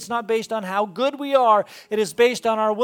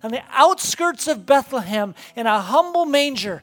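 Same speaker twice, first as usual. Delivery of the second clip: louder and higher, building sermon fervor; preaching at full force; 5 semitones higher, 6 dB louder than usual. Acted. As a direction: preach it with rising conviction. Preaching climax about God.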